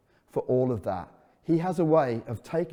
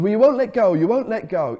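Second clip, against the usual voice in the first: put-on higher, louder pleading boy voice; anxious child plea; 7 semitones higher, 7 dB louder than usual. Son pleads with dad not to let go, higher anxious voice. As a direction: beg like a nervous child on a bike.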